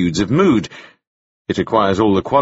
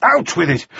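raised, exasperated command; impatient order; 7 semitones higher than usual. A narrator's reading. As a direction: demand that he speak up. Impatient master orders boy to speak.

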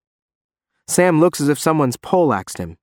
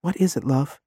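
tender; 3 semitones higher, 4 dB quieter than usual. Acted. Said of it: "a moment of awful tenderness".

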